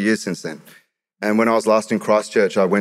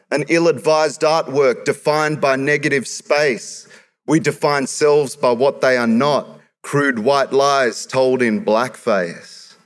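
raised, rhythmic slam poetry delivery; spoken word performance; 5 semitones higher, slower than usual. Acted. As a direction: drive the verses at the crowd.

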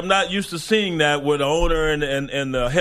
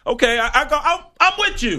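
laughing surrender, climbing higher; 11 semitones higher, faster than usual. Real, not acted. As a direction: give in through a laugh. Giving in with a laugh.

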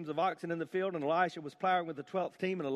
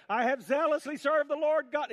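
whiny complaint; 9 semitones higher, 4 dB louder than usual. Acted. Mocking Elijah's repeated complaint.

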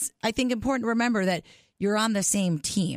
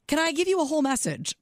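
playful quote; 8 semitones higher than usual. Retold or imitated. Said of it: "you do say to your husband".

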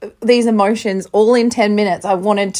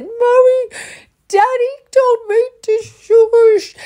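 put-on much higher, slow, tearful toddler voice; crying child imitation; 3 dB louder, slower than usual. Imitating her son telling mum, high tearful toddler voice. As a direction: whimper it like an upset small child. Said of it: "he came out and he said".

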